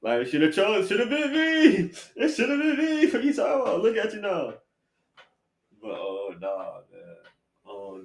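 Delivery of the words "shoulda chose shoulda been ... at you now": gloating ex. Retold or imitated. Gloating ex voiced in story.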